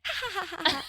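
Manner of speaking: high little-girl fake laugh; mock toddler laugh. Daughter taking back the bite, high teasing laugh. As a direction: tease with a high toddler giggle. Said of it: "and she's like".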